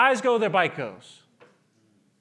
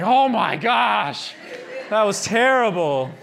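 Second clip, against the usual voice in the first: relieved gasp; slower than usual. Retold or imitated. Retelling panicked relief after motorcycle ride.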